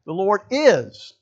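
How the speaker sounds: "is" drawn out and punched; stress on IS. Shown as a demonstration. Preacher showing congregation how to emphasize the word, stress on "is". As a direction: lean slowly and hard on "is". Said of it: "to emphasize the word is".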